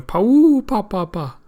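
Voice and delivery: high, loud hoot falling into short puffs; elephant trumpet. Acted. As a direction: imitate an elephant's call. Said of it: "this is Babanuki's elephant going".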